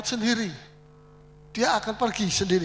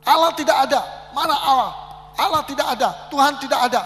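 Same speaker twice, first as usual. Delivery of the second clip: loud, higher repeated shouting; imitated ranting atheist; atheist yelling again; 5 semitones higher, 6 dB louder than usual. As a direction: keep hammering the denial at full voice. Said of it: "dia teriak-teriak lagi".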